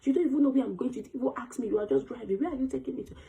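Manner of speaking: high, urgent retold screaming; screaming at the driver. Passenger screaming at a speeding taxi driver, high and frantic. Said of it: "I was screaming, like".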